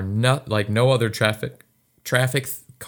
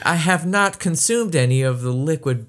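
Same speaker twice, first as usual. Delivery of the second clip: higher, prim overly formal voice; overly proper; 6 semitones higher than usual. Acted. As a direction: act stiffly polite to a cop. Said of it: "you get all proper and shit".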